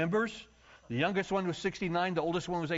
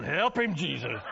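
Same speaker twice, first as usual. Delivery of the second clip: church lady plea; 3 dB louder, slower than usual. Retold or imitated. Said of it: "they started going".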